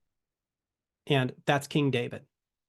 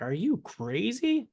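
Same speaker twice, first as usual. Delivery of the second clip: higher, slow disbelief with a changed voice; incredulous king; 7 semitones higher, slower than usual. Retold or imitated. The king asking David if he has lost his mind, slow disbelief. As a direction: draw out the question like he can't believe it. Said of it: "And the guy's like".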